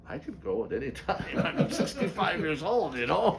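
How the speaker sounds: chuckling through the words; laughing. Real, not acted. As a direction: laugh while you say it. Laughing about his age.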